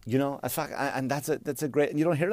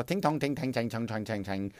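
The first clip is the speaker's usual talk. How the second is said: mock Chinese gibberish; 6 dB quieter than usual. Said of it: "Walk up to any Chinese person".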